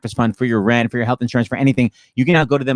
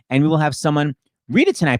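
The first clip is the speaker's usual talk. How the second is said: ordinary talk, nothing marked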